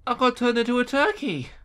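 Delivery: squealed, very high dismay; high-pitched lament. Real, not acted. Gamer reacts to being turned into turkey, high dismayed wail.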